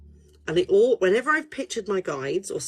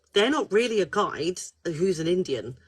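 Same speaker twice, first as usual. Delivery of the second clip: usual speaking voice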